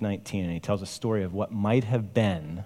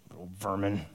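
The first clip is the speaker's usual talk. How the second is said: muttered under breath; 7 dB quieter than usual. A narrator's reading. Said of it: "Under his breath, he muttered".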